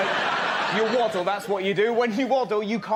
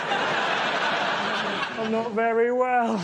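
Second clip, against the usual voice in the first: drawn out very slowly, pitched up; slow mock blurt; 3 semitones higher, much slower than usual. Comic imagining blurting to the doctor, very slow and drawn out. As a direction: stretch it out like a feeble confession. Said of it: "I didn't just".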